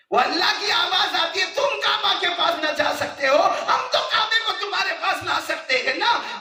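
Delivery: loud, high, driving sermon narration; impassioned sermon; full-force preaching. Acted. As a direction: paint the caravan scene for the crowd at full voice. Preaching the voice from beyond.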